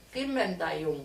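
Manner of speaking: hablando mientras mastica; boca llena. Real, not acted. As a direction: con comida en la boca. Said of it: "Perdón que hable con la boca llena". (con la boca llena)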